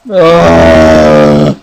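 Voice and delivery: long, loud, low "ahh" of dismay; dismayed groan. Real, not acted. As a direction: groan out the conceded goal. Concedes a goal in the game.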